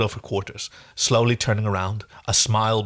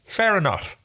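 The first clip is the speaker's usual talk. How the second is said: talking round a finger in the mouth; 7 semitones higher than usual. Acted. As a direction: say it with a finger stuck in your mouth. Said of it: "I can stick a finger in my mouth".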